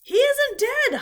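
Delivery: high, loud holler. A narrator's reading. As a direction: holler it across the ring. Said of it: "hollered Zuckerman".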